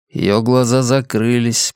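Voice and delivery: ordinary delivery, nothing marked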